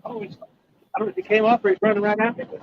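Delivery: talking while hauling in a fish; fighting a fish. Real, not acted. Reeling in a fish live.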